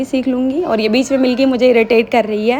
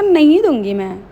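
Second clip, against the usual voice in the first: teasing refusal; 4 semitones higher, 6 dB louder than usual. Retold or imitated. Refusing to share mango with niece.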